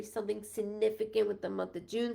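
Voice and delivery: ordinary talk, nothing marked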